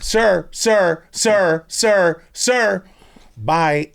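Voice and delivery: high, loud repeated calls; mock calling out. Hospital staff calling after an escaping patient, mock calling out. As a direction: call out after someone, insistently.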